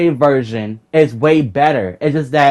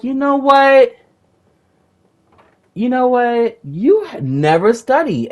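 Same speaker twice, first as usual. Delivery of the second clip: heated retort; 9 semitones higher, slower than usual. Retold or imitated.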